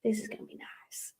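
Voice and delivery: whispered thought. Retold or imitated. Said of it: "and I was like".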